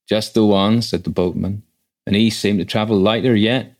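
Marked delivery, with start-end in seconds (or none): 0.1-0.8 gruff boatman. A narrator's reading. Voices the rough boatman. 2.1-3.7 gruff boatman. A narrator's reading. Voices the rough boatman.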